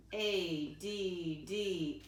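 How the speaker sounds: slow letter by letter spelling; spelled out slowly. Shown as a demonstration. Teacher spelling a word for her class, slowly. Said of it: "How do I spell can?".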